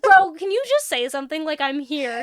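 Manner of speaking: high and loud, laughing exasperation; mock pleading with him to say something, cracking up; exasperated plea. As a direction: friend retelling the scene, acting out her exasperated plea to him.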